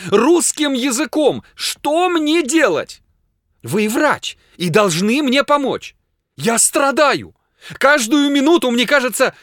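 very high, loud, near-hysterical outburst; frantic, anguished tirade; desperate pleading. A narrator's reading. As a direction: wail and demand help at a high pitch. Desperate patient begging his doctor.

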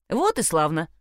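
usual speaking voice

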